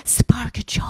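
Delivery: slow whispered title. Acted. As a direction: breathe it in a whisper.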